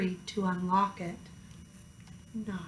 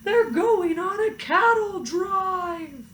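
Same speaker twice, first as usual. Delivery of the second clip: high, slow alarmed shout; farmer shouting; 8 semitones higher, slower than usual. A narrator's reading. Farmer shouting after the cows, high and slow. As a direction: farmer hollers after his runaway truck. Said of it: "The farmer shouted".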